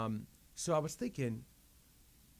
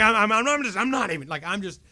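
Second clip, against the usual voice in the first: high, loud, stammering outburst; retold fit; 8 semitones higher, 9 dB louder than usual. Man rejecting the radio while hunting CDs, sputtering. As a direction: sputter in exasperation, re-enacting it. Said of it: "I'm just having a fit".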